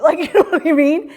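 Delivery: laughing through the question, high and loud; amused aside with a laugh. Real, not acted. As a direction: chuckle as you ask.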